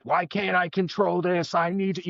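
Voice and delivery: mock frustration at yourself; lightly voiced frustration; frustrated. Retelling own frustration.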